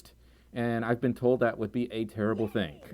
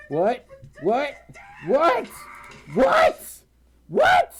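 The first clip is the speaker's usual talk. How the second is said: rising from high to piercingly high and loud; escalating disbelief; 12 semitones higher, 5 dB louder, much slower than usual. Real, not acted. Streamer stunned by donations, escalating shocked squeals. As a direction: repeat it higher and louder each time.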